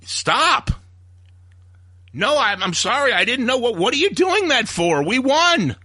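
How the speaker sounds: high, strained put-on voice begging them to stop; mock pleading Trump. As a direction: plead like a flustered man caught off guard.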